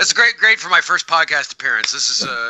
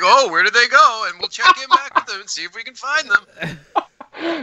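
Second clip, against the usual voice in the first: lilting, higher cheesy special voice; overdone wondering, higher pitched; mock TV special; 4 semitones higher, 4 dB louder than usual. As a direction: play it like a corny holiday show.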